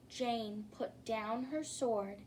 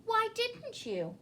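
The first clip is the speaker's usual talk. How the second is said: high, wondering why; genuinely puzzled high question; 11 semitones higher than usual. Acted. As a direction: ask it high and baffled. Jane questions the dragon.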